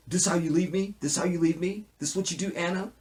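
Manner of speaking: high, pleading hurt voice; desperate plea. Retold or imitated. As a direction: beg her not to leave. Wyatt's desperate call to Anna.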